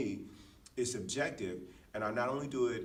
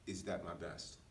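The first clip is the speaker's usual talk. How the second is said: soft, low inner question; quiet self-question; 6 semitones lower, 8 dB quieter than usual. Retold or imitated. Asking herself quietly what her best is. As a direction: ask it quietly to yourself. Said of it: "she asks herself".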